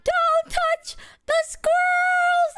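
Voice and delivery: very high, slow, drawn-out warning cry; retold frantic yell. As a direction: yell it while running over. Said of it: "he's running, like".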